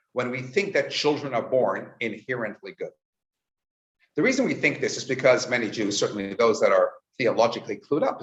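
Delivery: usual speaking voice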